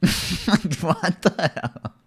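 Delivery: slow, drawn-out laughing; laughing disbelief. Real, not acted. Reacting to absurd claim.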